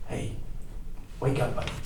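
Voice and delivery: soft, hushed wake-up call; hushed and careful; gentle rousing. Acted. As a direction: coax a sleeper awake, very quietly. Rousing an unconscious man gently.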